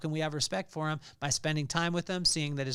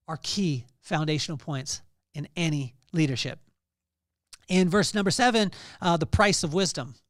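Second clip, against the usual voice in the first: preaching at full force; 6 dB louder than usual. Acted. Pastor preaching the verse to his congregation, forceful. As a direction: drive each word home.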